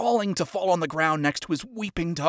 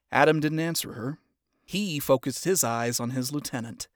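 ordinary delivery, nothing marked

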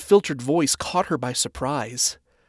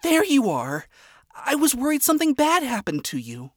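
higher, lightly worried relief; relieved Charlie; 12 semitones higher than usual. Acted. Charlie finding Alistair in the hallway, relieved and higher. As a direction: let the worry spill out as relief.

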